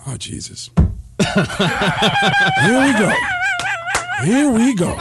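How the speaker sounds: exasperated groan drawn out high and slow; weary Pacino. Retold or imitated. Pacino groaning on seeing Baldwin in the elevator. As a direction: sigh it out like a man who sees trouble coming. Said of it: "Al Pacino looks at him and goes".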